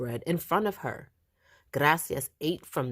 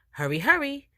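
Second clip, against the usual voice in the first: high, louder, pressing urging; urgent hurry; 11 semitones higher, 3 dB louder than usual. A narrator's reading. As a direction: urge them to hurry. Grandma hurrying to Mass.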